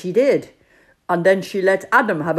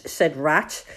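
usual speaking voice

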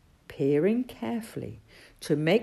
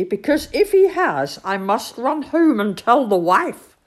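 high, loud, eager rush of news; excited old badger, voice raised; 8 semitones higher, 8 dB louder than usual. A narrator's reading. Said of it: "said old Badger excitedly".

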